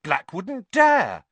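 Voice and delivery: loud, high, slow and emphatic; plucky defiance; spirited. A narrator's reading. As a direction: declare it with bold confidence. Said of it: "said Ron spiritedly".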